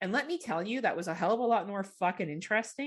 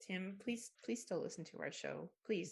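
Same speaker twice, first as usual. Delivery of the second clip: soft, hushed mock begging; whispered-style mock plea to a fan; mock pleading; 11 dB quieter than usual. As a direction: plead quietly, half joking. Begging a listener to stay.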